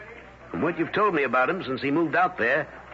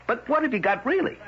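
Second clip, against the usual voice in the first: incredulous challenge; 8 semitones higher, faster than usual. Acted. Editor challenging the reporter's evidence.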